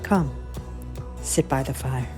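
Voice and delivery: usual speaking voice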